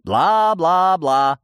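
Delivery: slow, loud, high blah-blah mockery; scoffing. A narrator's reading. Narrator dismisses the girl's threat, scoffing. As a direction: drag out each syllable mockingly. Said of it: "усмехнулся я".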